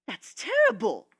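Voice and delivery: loud, slow, drawn-out gasp of horror; shocked exclamation. A narrator's reading. Rarity horrified by the griffin's dragon story, exclaiming loudly. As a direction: cry it out in dismay. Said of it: "Rarity exclaimed".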